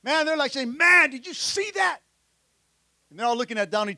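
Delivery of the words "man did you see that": higher-pitched, amazed disciple's exclamation; pumped up. Acted. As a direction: act out a thrilled disciple who just saw a miracle. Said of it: "those disciples are pumped up".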